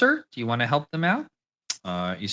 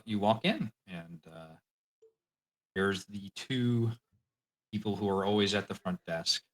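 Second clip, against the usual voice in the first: ordinary talk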